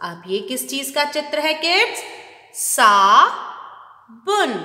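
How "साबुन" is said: "साबुन" drawn out very slowly and louder; slow modelled word. Shown as a demonstration. Teacher to kids, slowly modelling "साबुन". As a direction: stretch "साबुन" for the class.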